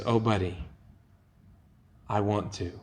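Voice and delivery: quiet and kind, almost murmured; gentle Jesus. Retold or imitated. Jesus answers the leper kindly.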